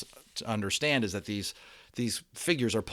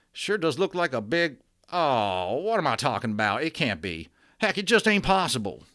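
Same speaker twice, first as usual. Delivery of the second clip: put-on cowboy accent; 4 semitones higher, 4 dB louder than usual. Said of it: "attempt at a cowboy sounding accent".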